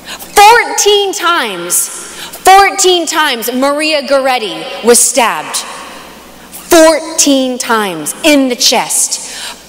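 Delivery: very slow, every word weighed; slow, heavy emphasis. Real, not acted. Speaker to the youth group, slow grave emphasis. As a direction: let Fourteen times land slowly and hard.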